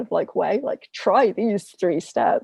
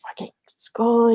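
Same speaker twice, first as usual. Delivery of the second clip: a mock annoyed whisper, slowed down; 3 semitones higher, 5 dB louder, slower than usual. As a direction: hiss it under your breath, slowly, fed up with the school. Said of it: "kind of a little bit annoyed with the school".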